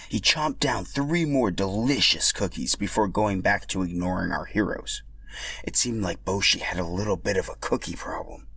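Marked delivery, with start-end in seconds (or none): none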